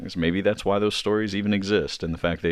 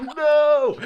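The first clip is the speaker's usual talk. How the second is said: high laughing shout; mock scream; 7 dB louder than usual. Gnome hunter tied down, screaming in mock terror.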